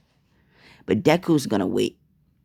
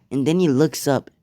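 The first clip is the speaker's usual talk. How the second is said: plain reading voice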